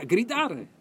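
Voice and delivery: mild shout. Shown as a demonstration. Preacher demonstrates the crying out he urges.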